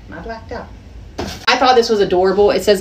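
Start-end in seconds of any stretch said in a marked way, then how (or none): 0.1-0.7 much softer, trailing away; faded to near silence. Real, not acted. Soft trailing mumble. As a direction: haul mumbling it to friends, fading to nothing.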